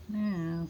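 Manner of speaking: a tiny meow to the cat; hushed high cat call; soft meow. Retold or imitated. Meowing at the cat.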